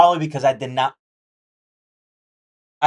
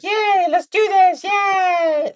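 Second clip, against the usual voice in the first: high, squealing fake glee; mock cheerful cheer; 14 semitones higher, much slower than usual. Mocking a trader's giddy cheer before a bad day. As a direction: squeal it like a giddy trader who ignores the warning signs. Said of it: "be like".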